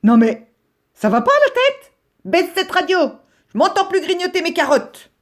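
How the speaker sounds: grey rabbit yelling at his noisy neighbour, high and outraged; outraged complaint. Acted. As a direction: snap at him to turn the radio down. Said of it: "de se plaindre".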